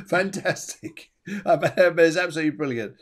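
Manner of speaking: amused, slowed "Fantastic" through laughter; laughing. Real, not acted. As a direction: laugh the word out. Laughing at own joke.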